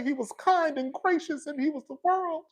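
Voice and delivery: high, soft, sugary mock praise of him; mock gushing tribute. Widow mocked with a high, soft, sugary tribute. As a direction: lay on the fake sweetness. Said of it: "You're supposed to say".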